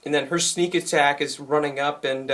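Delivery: ordinary delivery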